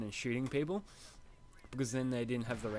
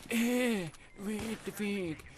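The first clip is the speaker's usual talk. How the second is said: high, slow dopey voice; derpy kid voice; 8 semitones higher, much slower than usual. Retold or imitated. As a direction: play a clueless derpy kid. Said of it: "He's like".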